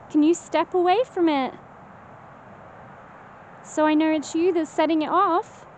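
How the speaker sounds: calling out pleading. Real, not acted. Pleading with a spirit.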